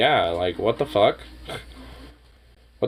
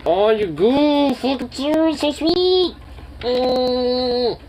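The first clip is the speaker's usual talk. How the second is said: pitched way up, cooing at the dog; sweet pet voice, drawn out on goof; 13 semitones higher, much slower than usual. Real, not acted.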